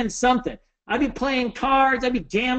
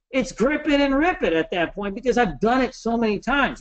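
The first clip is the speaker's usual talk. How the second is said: ordinary talk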